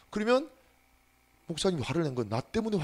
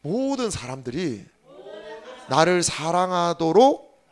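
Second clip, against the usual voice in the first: loud, fervent preaching call; leading the congregation in a shouted confession; call and response; 3 semitones higher, 7 dB louder than usual. Real, not acted. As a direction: proclaim each phrase for the church to echo. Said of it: "따라해봅시다".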